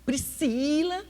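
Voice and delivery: slow, stern call of a name; God calling Priscila to wake up, slow and stern; serious God voice. Retold or imitated. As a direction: wake her up firmly. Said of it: "fala um pouquinho sério".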